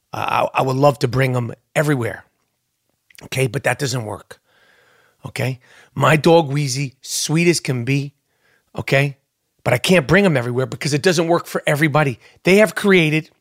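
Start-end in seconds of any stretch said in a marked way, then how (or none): none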